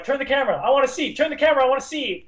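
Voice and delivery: high, mock pleading; hammed-up exasperated plea; frustrated viewer. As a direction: beg the screen, insistent and high-pitched. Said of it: "you're like".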